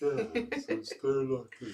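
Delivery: soft, quiet lisping Karloff voice; a hint of a gentle horror star; Boris Karloff impression. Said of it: "This is a horrible a-, imitation".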